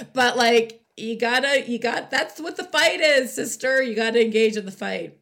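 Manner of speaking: loud, high pep-talk urging; rally her to fight; fired-up rallying. Real, not acted. Rallying call to Katie Hobbs.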